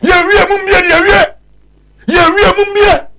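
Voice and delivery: loud, high-pitched ranting shout; shouting his frustration at the system; heated shout. Real, not acted. Ranting to friends about hospitals, shouting.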